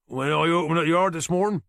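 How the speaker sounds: a Norfolk boatyard man answering the inspector; thick Norfolk accent. Acted. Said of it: "he said with a thick Norfolk accent".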